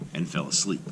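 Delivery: ordinary delivery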